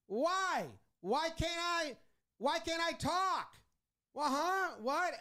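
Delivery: high, indignant complaining; mock outraged why; whiny manager protest. Manager voiced arguing, ejected.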